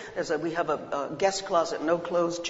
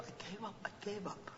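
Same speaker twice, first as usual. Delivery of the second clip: fading; 18 dB quieter than usual. Acted.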